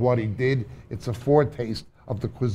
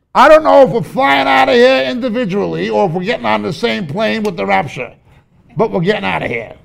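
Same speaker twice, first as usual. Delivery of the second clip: joyful preaching; 8 semitones higher, 8 dB louder than usual. Real, not acted. Joyful climax about rapture.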